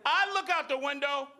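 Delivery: normal talking voice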